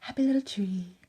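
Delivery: soft unvoiced whisper of a gentle TV painter; Bob Ross impression. Blending eyeshadow, she jokingly channels the painter, hushed whisper. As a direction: breathe it out like Bob Ross dabbing a little tree. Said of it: "I feel like Bob Ross".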